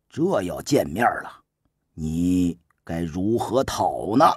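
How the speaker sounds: a shrewd minister calmly coaching, voiced low and soft; Su Qin's voice. Su Qin calmly instructs the young prince, low and soft.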